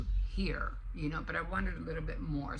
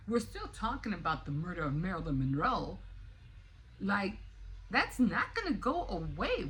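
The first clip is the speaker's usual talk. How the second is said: ordinary delivery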